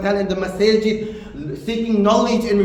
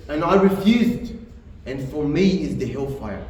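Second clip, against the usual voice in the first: ordinary delivery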